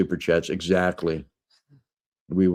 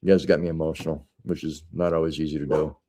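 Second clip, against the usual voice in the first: teary; 4 semitones lower, 4 dB quieter than usual. Real, not acted. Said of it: "now I'm getting teary".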